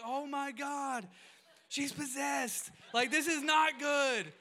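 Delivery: high, loud, drawn-out alarm; mock panic. Dad thinks his daughter is possessed, mock panic. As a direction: wail it slowly in exaggerated horror.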